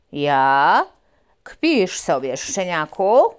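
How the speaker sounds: loud big dog scoffing; gruff mongrel. Acted. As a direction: sneer at the puppy.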